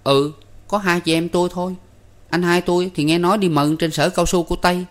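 put-on high-pitched boyish character voice; boy Năm, chatty. A narrator's reading. Năm tells the guest about his family, high and chatty. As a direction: explain in a high, youthful voice.

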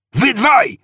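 high-pitched hailing shout; yell. A narrator's reading. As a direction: yell out to two gunmen. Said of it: "wrzasnął". (wysoki wrzask)

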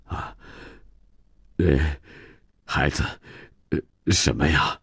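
soft, faltering question between small fillers; hesitant father. Acted. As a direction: ask haltingly, feeling your way. Shamed father hesitantly asks his son. (迟疑地轻声问孩子)

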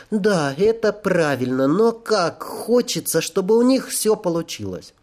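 put-on high, wistful girl's voice; sad-smiling girl angel. A narrator's reading. Angel hopes they will succeed, high wistful voice. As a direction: lift it high, smiling sadly with longing. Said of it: "Альена грустно улыбнулась".

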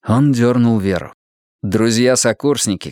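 ordinary delivery, nothing marked

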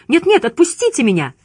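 high, pleading protest; panicked cry. A narrator's reading. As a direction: cry out to be released. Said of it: "закричала Лиза".

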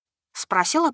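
usual speaking voice

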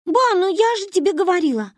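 put-on high, loud little girl whine; protesting child. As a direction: butt in indignantly. Manya butts in on grandmother.

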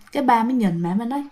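usual speaking voice

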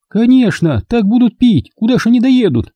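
woman's voice. A narrator's reading. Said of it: "Продолжила её мысль миловидная Валя".

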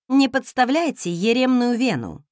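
ordinary delivery, nothing marked

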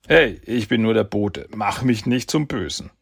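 defensive Yoshi. Acted. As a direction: justify yourself, hands up. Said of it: "rechtfertigt sich der Spielverderber".

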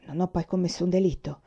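ordinary delivery

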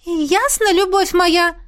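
put-on high-pitched ghost girl voice; obedient ghost. A narrator's reading. Ghost takes orders from her beloved, high-voiced. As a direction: answer high, as an adoring ghost.

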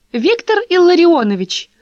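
high, loud, clear call; ringing call. Acted. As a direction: call out brightly across the garden. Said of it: "говорила звонко". (звонко)

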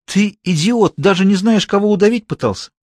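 high-pitched, scathing put-down; withering contempt. A narrator's reading. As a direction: tear into a useless subordinate. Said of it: "уничтожающе сказал Белов". (уничтожающе, с презрением)

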